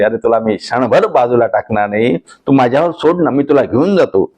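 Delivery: normal talking voice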